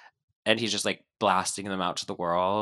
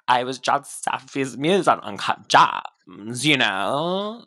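slow, loud vocal fry imitation; Julia Fox impression; 3 semitones higher, 7 dB louder, slower than usual. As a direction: drawl it like a lazy It-girl. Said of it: "I sound like that".

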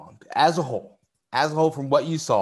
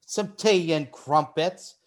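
British accent; 3 semitones higher, much slower than usual. Shown as a demonstration. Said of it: "if I wanna go British".

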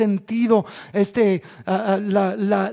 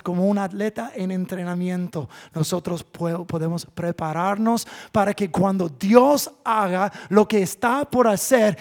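ordinary talk

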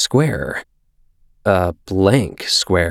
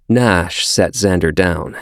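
ordinary delivery, nothing marked

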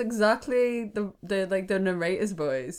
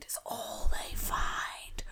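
spooky whisper; 8 dB quieter, much slower than usual. Acted. Spooky podcast whisper for effect.

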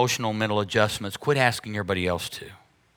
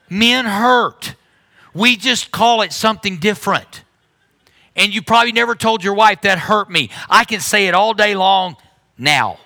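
much higher, louder, calling out the men; preaching at full force; 9 semitones higher, 6 dB louder than usual. Real, not acted. Pastor calling out the men in the church, loud and high. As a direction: shout it out, teasing.

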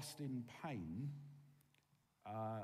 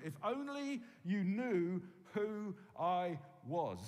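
lament; 5 semitones higher, 5 dB louder, slower than usual. Retold or imitated. Jesus lamenting over Jerusalem.

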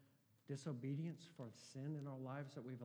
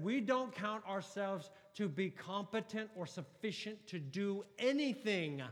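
loud, high, impassioned preaching; fiery sermon delivery; preaching at full force; 7 semitones higher, 11 dB louder, slower than usual. Acted. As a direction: proclaim it to the back row. Preacher quoting scripture at full force.

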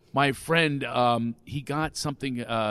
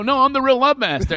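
high, loud mock insistence; hammed-up defensive protest; 9 semitones higher, 6 dB louder than usual. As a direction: re-enact an indignant claim to be the real one. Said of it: "I start getting defensive".

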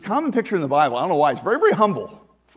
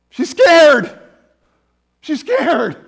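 emphatic scared; 9 semitones higher, 10 dB louder, much slower than usual. Acted. Preacher stressing Mary's fear.

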